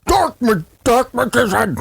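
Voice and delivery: high, hammed-up card call; mock anime yell. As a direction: shout it like an anime duelist. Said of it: "Yu-Gi-Oh would just start yelling".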